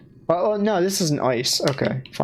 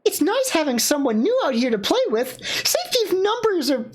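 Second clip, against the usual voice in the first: high character voice; 13 semitones higher than usual. Acted.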